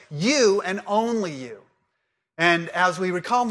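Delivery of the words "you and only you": slow, with "you" stressed both times. Shown as a demonstration. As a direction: lean slowly on each "you". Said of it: "it really means this".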